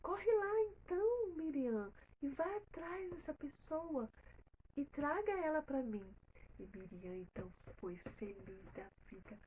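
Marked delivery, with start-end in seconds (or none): 0.0-6.1 high, loud eager order to hurry; a princess thrilled, urging the girl to run; pitched-up happy command, louder; excited princess. Acted. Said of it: "a princesa ficou satisfeita e falou".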